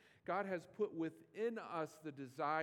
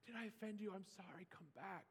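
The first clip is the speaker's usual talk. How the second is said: much softer, pleading sorry; meek apology; 5 semitones higher, 8 dB quieter than usual. Retold or imitated. Jesus imagined apologizing softly to leaving disciples. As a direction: plead gently so they return. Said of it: "So he might say".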